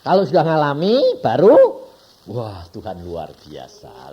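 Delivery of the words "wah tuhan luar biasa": quiet awe. Retold or imitated. Quoting awed believer.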